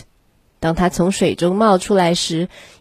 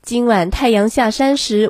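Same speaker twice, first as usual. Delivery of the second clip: ordinary delivery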